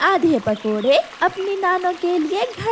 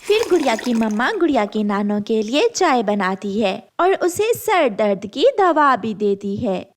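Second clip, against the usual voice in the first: plain reading voice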